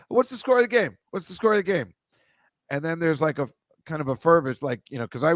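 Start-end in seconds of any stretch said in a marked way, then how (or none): 0.1-1.9 high, lively onstage call to the crowd; an arena singer quizzing the crowd, pitched high. Retold or imitated. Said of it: "and then he goes".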